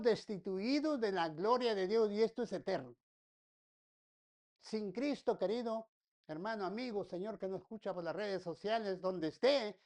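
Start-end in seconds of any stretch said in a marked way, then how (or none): none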